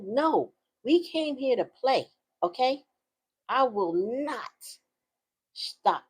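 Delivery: mock-stern mom refusing to quit playing; playful defiant refusal. Acts out refusing her embarrassed daughter.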